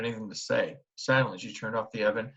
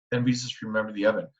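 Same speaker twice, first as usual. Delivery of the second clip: usual speaking voice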